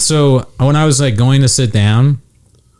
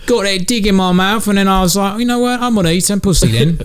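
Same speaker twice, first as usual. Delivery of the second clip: high-pitched mock stand-up voice with a British "blimey"; put-on comic character; 8 semitones higher than usual. Imagining an actor's crude showcase set, high put-on comic voice. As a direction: play a silly comic doing a crude bit, pitched way up.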